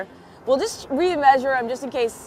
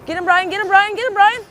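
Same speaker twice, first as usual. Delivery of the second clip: loud, high, urgent cheering; shouting encouragement; 7 semitones higher, 7 dB louder than usual. Real, not acted. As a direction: yell him on to reel it in. Cheering on a catch.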